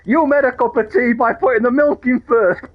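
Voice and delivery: mock a ridiculous excuse for shooting; mock petty accuser.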